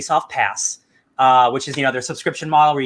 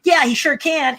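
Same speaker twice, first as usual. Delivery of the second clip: put-on kid voice; 10 semitones higher, slower than usual. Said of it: "the kid's like".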